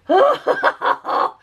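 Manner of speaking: squealing high laugh; high giggle. Real, not acted. Vlogger giggling at her paint, high squeal.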